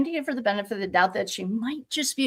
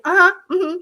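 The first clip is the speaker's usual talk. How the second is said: mocking the witness; 8 semitones higher, 7 dB louder than usual. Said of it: "And she's like".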